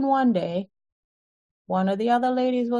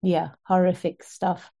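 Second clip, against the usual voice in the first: normal talking voice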